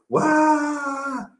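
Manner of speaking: put-on high "Uá!" cry of a hurt toddler; hammed-up baby cry after a fall; mock child wail. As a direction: imitate a child bursting into tears. Imitating child after falling.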